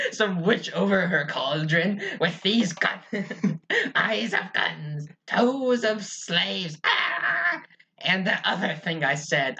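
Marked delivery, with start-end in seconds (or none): 0.1-7.6 slow, higher cackling witch voice; laughing mock witch reciting ingredients; witch at the cauldron. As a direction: gloat over the brew like a witch. Playing a witch brewing.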